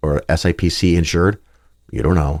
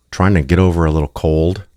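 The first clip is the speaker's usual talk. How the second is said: normal talking voice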